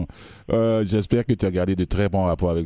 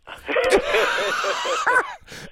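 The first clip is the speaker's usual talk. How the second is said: big burst of laughter. Real, not acted. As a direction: laugh out hard, high and free.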